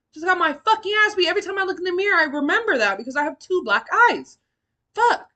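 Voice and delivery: anguished loud. Real, not acted. Recalling beating, depressed.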